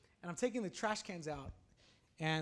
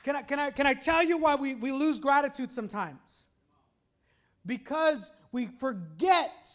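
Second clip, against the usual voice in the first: raised, loud preacher's voice; emphatic preaching; 5 semitones higher, 11 dB louder than usual. Real, not acted. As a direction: press the question on the congregation. Preaches to the congregation.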